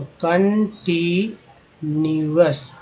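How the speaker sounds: कंटीन्यूअस drawn out slowly, ending in -अस; कंटीन्यूअस teacher-style slow; slow clear word. Shown as a demonstration. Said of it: "ओयूएस को अस बोलते हैं".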